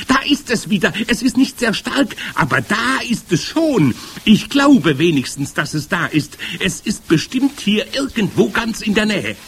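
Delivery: high, insistent witch wail; howling witch. A narrator's reading. First witch insists the smell is close by, howling. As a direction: keep wailing high, easing in volume. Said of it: "heulte die erste Hexe".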